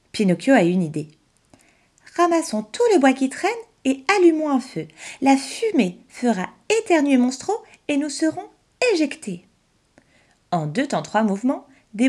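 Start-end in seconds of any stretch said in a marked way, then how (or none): 2.2-9.5 put-on higher boy's voice, keen and quick; eager puppet boy. Pinocchio tells his father his plan, eager boy voice. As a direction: pitch the plan like a proud kid.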